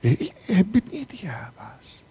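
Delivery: hushed confiding whisper; whispered aside. Real, not acted.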